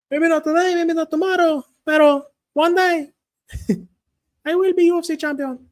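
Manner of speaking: put-on very high, loud Mexican-accented boast; Brandon Moreno. Fighter vowing to become champion, high Mexican accent. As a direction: proclaim the future title like a Mexican fighter. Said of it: "The pero in the sentence gets me".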